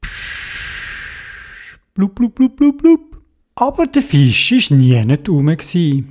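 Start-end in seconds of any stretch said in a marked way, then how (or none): 0.0-1.8 snorkel hiss. A narrator's reading. Snorkel breath sound. 1.9-3.2 bubbling sounds. A narrator's reading. Bubbles rising underwater.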